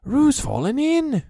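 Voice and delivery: high, loud alarmed call; alarmed cry. A narrator's reading. Rabbit calling to the others, high loud alarm. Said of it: "cried Rabbit".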